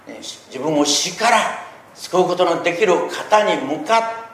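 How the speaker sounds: loud, high, slow preacher's cry; fervent preaching. Acted. Pastor urging the congregation to pray aloud, fervent loud preaching. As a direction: pour out the heart in a loud, drawn-out sermon voice.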